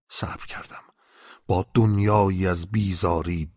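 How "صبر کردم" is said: whispered. A narrator's reading. Gives in, whispered aside.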